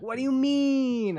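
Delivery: high, innocent whine of confusion; mock-innocent friend playing clueless; Soma impression. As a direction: play dumb in a high squeaky voice. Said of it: "that's my Soma impression".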